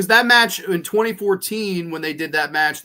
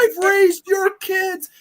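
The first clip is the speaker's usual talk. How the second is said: high, slow mocking Cena impression; mock Cena yell; 13 semitones higher, 4 dB louder, much slower than usual. Mocking Cena yelling at a kid, high and slow. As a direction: stretch it out high like a ranting Cena. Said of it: "he's like".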